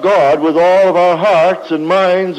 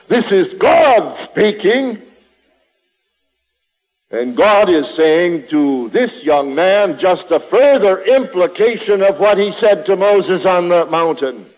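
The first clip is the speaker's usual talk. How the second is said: ordinary talk, nothing marked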